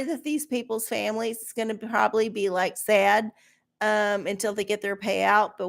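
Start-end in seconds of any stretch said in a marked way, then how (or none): none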